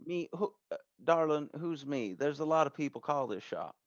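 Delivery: puzzled shop owner questioning a caller; folksy shop owner. Acted. Game master plays Denise on the phone.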